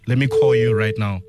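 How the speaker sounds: usual speaking voice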